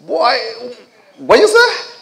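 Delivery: high-pitched, incredulous mother from the Caribbean; imitated Caribbean mother. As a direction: squeal it loudly like a shocked Caribbean mum in London. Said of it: "Caribbean woman living in London with this wonderful British accent".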